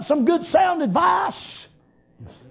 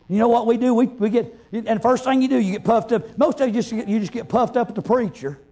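ordinary delivery

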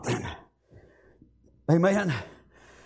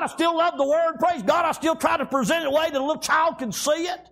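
raised, driving preacher's cadence; fervent preaching; 6 semitones higher than usual. Acted. Pastor exhorting his flock to worship, fervent. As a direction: testify with steady fire.

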